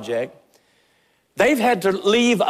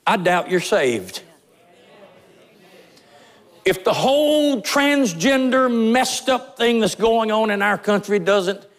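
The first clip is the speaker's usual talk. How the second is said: fervent sermon warning; preaching; 5 semitones higher than usual. Real, not acted. Pastor confronting his congregation, preaching with fervor. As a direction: drive it home to the congregation.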